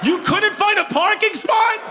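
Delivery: high-pitched, hammed-up exasperation; mock scolding. Comedian scolds late front-row arrivals, high mock outrage. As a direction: berate them in playful disbelief, pitch way up.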